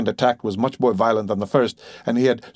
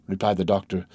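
ordinary delivery

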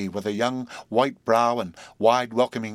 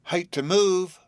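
lazy sigh; 8 semitones higher, 4 dB louder, slower than usual. Acted. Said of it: "sighed Sally Carrol lazily".

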